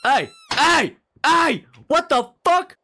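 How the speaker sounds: very high, loud shouts; frantic yelling. Real, not acted. Gamer screaming at an intruder in the room, frantic.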